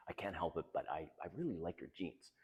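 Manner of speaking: soft, low, hesitant admission; bashful confession. Acted. Man admitting he likes her jeans, bashful. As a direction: confess it quietly, as if to a date. Acting his confession to a date.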